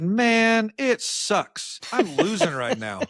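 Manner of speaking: high, drawn-out mock lament; exasperated complaint. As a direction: replay a loser's whine, loud on MAN. Replaying his own loud complaint.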